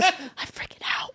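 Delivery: slow whispered mock panic; panicked whisper. High friend confesses she is freaking out, panicked whisper. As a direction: confide the panic in a hushed whisper.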